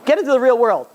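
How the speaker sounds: exasperated urging. Retold or imitated. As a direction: shake them out of it. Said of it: "I'm like".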